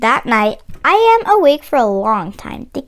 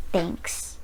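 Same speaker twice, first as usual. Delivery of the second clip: softer, breathy whispered thanks; 7 semitones lower, 12 dB quieter than usual. Acted. Antonio quietly thanking his friend in line, whispered.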